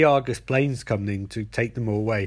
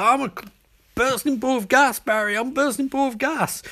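high, excited mock battle cry; high-pitched mock bragging; hammed-up villain boast; 11 semitones higher than usual. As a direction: crow it like a cartoon villain attacking. Villain boasts while attacking.

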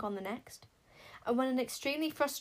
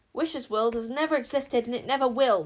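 loud, higher shout; yelling; 4 semitones higher, 8 dB louder than usual. A narrator's reading. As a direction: yell it up at the door. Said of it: "Queen Sycorax yelled".

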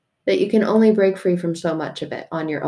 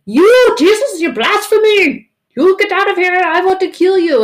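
high, louder hammed-up outrage; mock angry crowd; 12 semitones higher, 7 dB louder than usual. Crowd to Jesus, hammed-up furious yelling. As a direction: shout him down like a mob.

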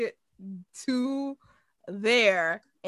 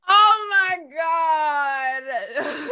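shocked shout; 6 semitones higher, 7 dB louder than usual. Real, not acted. Reacting to outrageous joke.

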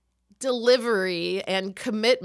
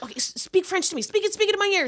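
fast, higher, stammered pleading; mock panicked rush; retold panic; 8 semitones higher, faster than usual. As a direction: beg a teammate urgently. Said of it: "I would have a panic attack".

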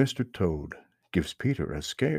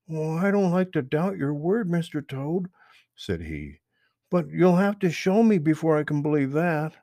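knowing Peter Rabbit; 7 semitones higher than usual. Acted. Peter questions Mister Toad, high and knowing. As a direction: pitch up and sound clever.

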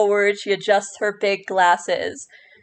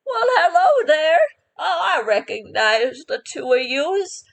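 put-on a high, creaky old-lady hello; old woman voice; 7 semitones higher than usual. Old shopkeeper greets the returning adventurers, high and creaky. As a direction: pop up cheerful like a little old shopkeeper. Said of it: "the voice of the old woman".

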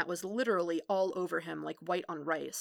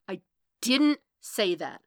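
louder, insistent denial hammering DIDN'T; angry denial; 6 dB louder than usual. Acted. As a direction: punch DIDN'T loudly as the temper rises. Denial escalates in the quarrel.

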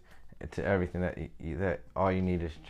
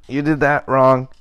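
"you did that wrong" yelled very loud and higher, slowly, leaning on "did"; mock accusing yell; 5 semitones higher, 17 dB louder than usual. Mocking critics of Christians.

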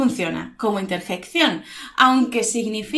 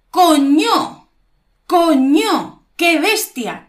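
high, loud exclamation of shock; price shock; 6 semitones higher, 7 dB louder, much slower than usual. Shown as a demonstration. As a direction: demonstrate alarm at a price tag. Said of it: "y te asustas".

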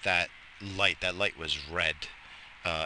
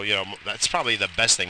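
usual speaking voice

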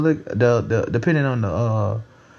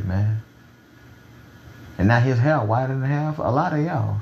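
ordinary talk, nothing marked

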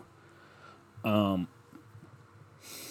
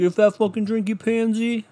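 mock taunt; 11 semitones higher, 3 dB louder than usual. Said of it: "and you're like".

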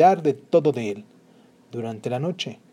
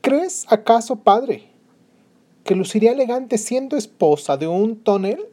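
scornful, higher and louder; haughty princess; 8 semitones higher, 4 dB louder than usual. Acted. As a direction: sneer at the suitor to her father. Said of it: "dijo al rey".

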